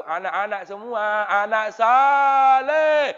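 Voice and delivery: high, loud, slow sing-song like a clown; clownish kindergarten teacher. Retold or imitated. Preacher playing a clown for kindergarten kids, high sing-song. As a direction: stretch it out like a children's entertainer. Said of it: "Oh, kayak badut laku".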